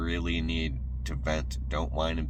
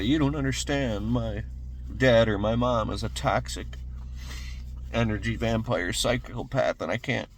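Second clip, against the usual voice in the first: complaining excuse; 6 semitones higher, 3 dB louder than usual. Retold or imitated. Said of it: "And if you say".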